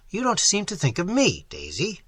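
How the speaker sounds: ordinary delivery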